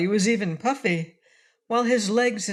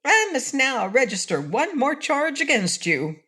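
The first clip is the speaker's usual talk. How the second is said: satisfied; 7 semitones higher than usual. A narrator's reading. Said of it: "with evident satisfaction".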